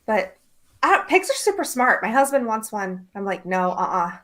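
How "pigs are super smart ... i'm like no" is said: normal talking voice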